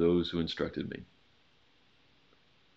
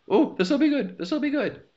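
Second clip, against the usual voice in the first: much higher, hammed-up giddy voice of desire; mock eager excitement; 4 dB louder than usual. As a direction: play the greedy inner voice, gleeful and high. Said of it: "the klaxon is blaring in our ears and head of".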